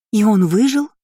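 loud, high shocked question; amazed, voice leaping up; astonished gasp. A narrator's reading. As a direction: gasp it out, rising sharply at the end. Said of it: "ахнула девушка".

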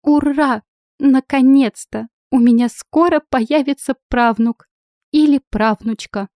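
high squeals of joy, loud; визг от радости. Acted. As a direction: shriek it like a happy schoolgirl. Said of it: "завизжала от радости". (радостно визжа, как школьница)

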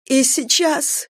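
high, slow, sobbing; breaking into sobs; sobbed. A narrator's reading. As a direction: force the words out through tears. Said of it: "прорыдала я".

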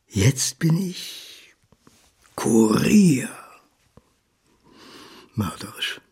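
slow bitter whisper. Acted. Son confessing his lowly job, whispered. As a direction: breathe it out in shame.

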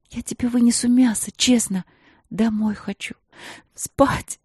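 tearful pleading. Acted. Tearful plea, then sob.

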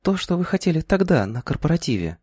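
high voice fading to a hush; hint slyly, trailing off; coy young woman. A narrator's reading. Клара намекает на корпоратив. (кокетливый женский голос)

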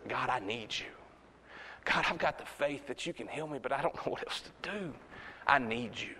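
soft, low voiced prayer; humble plea. A needy believer talks to God, humble plea. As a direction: drop the volume, as if quietly praying. Acts out a person praying.

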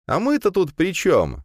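high, bright-eyed student voice pleading with the rector; a hopeful young man asking a favour; eager young student. A narrator's reading. Student hopefully asks the rector about a club, in a high young voice.

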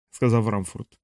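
plain reading voice

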